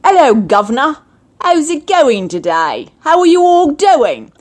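hammed-up Cockney greeting; Cockney accent. Shown as a demonstration. Host demonstrating accents in England, exaggerated Cockney accent. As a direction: do a broad mock Cockney. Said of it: "when you have Cockney".

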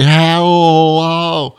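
high, stretched-out hello; eager mock hello, held high; singsong greeting. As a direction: call out a long, hammed-up greeting. Repeats the rehearsed greeting.

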